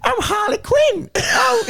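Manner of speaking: very high, slow, squawking cartoon villainess; hammed-up shrieky impression, stretching the words; Harley Quinn. Answering the Joker, piercing mock cartoon voice. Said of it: "attempt at a Harley Quinn impression".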